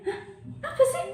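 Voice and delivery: baffled and indignant at once; retold reaction to breakup talk; indignant disbelief. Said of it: "orang emosi kan".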